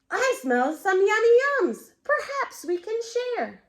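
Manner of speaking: high, loud eager badger. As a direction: sniff out the treat with loud glee. Badger voiced in story.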